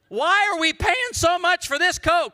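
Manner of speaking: loud, high-pitched mock protest; hammed-up complaint. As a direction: complain at full volume, comically indignant. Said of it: "Somebody complained".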